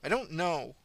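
quick, sharp retort; snapped. A narrator's reading. Marnie cutting off the nosy patient, a sharp snap. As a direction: bite the answer off sharply. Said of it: "Marnie snapped".

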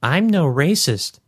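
slow high-pitched protest; protesting woman. A narrator's reading. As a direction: draw it out high, insisting on innocence. Narrator voices protesting woman.

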